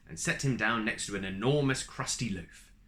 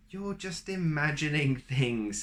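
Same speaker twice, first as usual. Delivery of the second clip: soothing wife; 5 semitones higher, 4 dB louder than usual. Acted. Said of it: "said his wife".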